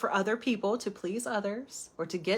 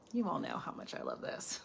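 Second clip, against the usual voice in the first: lower, sarcastic whisper; 4 semitones lower, 11 dB quieter than usual. Real, not acted. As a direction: murmur the irony to the viewers. Sarcastic aside to viewers.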